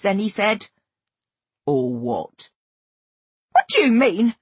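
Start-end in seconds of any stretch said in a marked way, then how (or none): 1.7-2.5 low, offhand challenge; flippant. A narrator's reading. Ralph half amused by her demand, flippant. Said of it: "His tone was flippant, jokey". 3.5-4.3 high, loud, upset question; crying out. A narrator's reading. Narrator thrown by Ralph's challenge, crying out. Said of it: "I cried".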